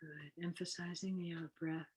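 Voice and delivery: softly spoken breathing-exercise guide; guided meditation. Acted. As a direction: lead them gently into the breathing, unhurried.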